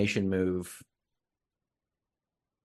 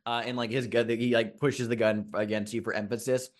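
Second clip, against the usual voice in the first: ordinary delivery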